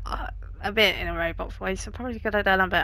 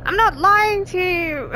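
high, slow, insistent denial; high pleading protest; 9 semitones higher, 6 dB louder than usual. Real, not acted. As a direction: protest your innocence loudly. Repeats protest to computer.

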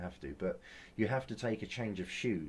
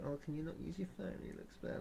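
high, breathy mock of a nagging staff member; mocking fussy gym staff; 7 semitones higher, 9 dB quieter, faster than usual. Mimicking gym staff scolding him about his phone, mock nagging. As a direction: imitate the staff nagging, higher and softer. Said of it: "decided to moan at me and go".

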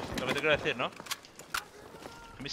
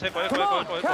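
high rapid calls of eco; urgent high shout; 6 semitones higher, 5 dB louder than usual. Real, not acted. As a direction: yell eco over and over. Urgently calling objective to team.

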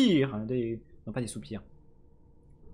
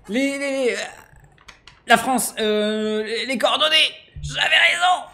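high, loud triumphant shouting; excited shout; 10 semitones higher, 8 dB louder, slower than usual. Real, not acted. Gamer cracks the coordinates puzzle, shouting with glee.

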